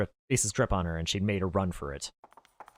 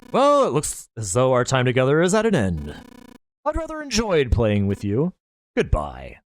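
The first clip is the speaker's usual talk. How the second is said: higher, mocking, smug killer voice; gloating villain; 5 semitones higher, 7 dB louder than usual. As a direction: savour every cruel word. Villain bids farewell before shooting.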